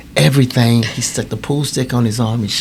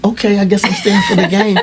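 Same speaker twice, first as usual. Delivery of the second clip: laughing; 9 semitones higher, 3 dB louder than usual. Retold or imitated. Teller giving in, laughing.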